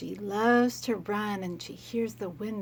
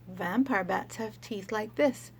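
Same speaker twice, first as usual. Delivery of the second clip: ordinary talk, nothing marked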